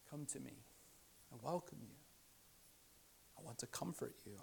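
soft, hushed reassurance; tender welcome. Acted. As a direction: speak quietly, offering comfort to the weary. Jesus offering comfort, open arms.

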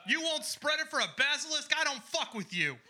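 high-pitched comic indignation; mock outraged rant. Comedian declaring war on everyone tonight, mock outraged.

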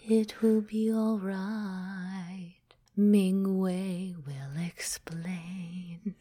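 slow breathy dragon whisper. Shown as a demonstration. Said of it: "this is the dragon talking".

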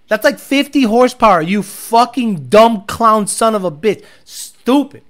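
loud, furious insults; angry rant. Real, not acted. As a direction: rip into him loudly. Ranting at a poster.

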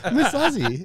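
high, laughing fake Japanese accent; mock Japanese accent. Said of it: "give us a little Japanese".

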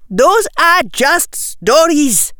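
high, slow emphatic protest; yelled. Acted. Parvati dismissing Lavender's tales, yelled protest. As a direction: yell it in a high girl's voice. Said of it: "yelled Parvati".

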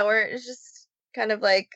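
ordinary talk

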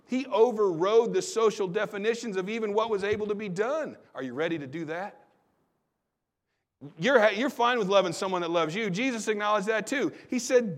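ordinary delivery

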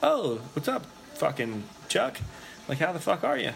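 high, casual mock greeting; feigned familiarity. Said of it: "as if they're not questionable characters".

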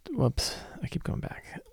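soft, breathless whisper to self; whispered whoops at a misbehaving page; whispered aside. Real, not acted. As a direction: whisper a little oops under the breath. Muttering at software.

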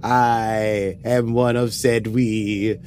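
put-on very slow, inconsistent British accent; weird British accent. Host opens the Star Trek podcast, drawn-out wobbly British accent. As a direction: draw it out like a hammy British announcer. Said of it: "the weird British accent that's inconsistent".

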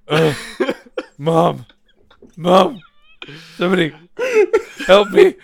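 loud, high, whimpering calls; mock cry for help. Drugged patient calling Mom as dog tugs tube, mock tearful plea. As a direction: wail weakly for rescue, hammed-up. Acts helpless call for mom.

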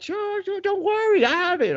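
put-on high old lady voice, breezy; bakery lady reassuring the dad about the cake, high and breezy; mock old lady. Old woman promising, high pitched.

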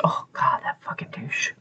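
whispered exasperation; disgusted aside. Retold or imitated. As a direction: whisper it under your breath in disgust. Her aside reacting to the villain.

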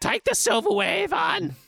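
very high comic falsetto; hammed-up squawked order; mock nagging. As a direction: urge in a silly high-pitched voice. Mock urging of Ivan to pack.

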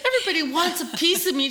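mock drama through a laugh; laughing mock complaint to her sister.

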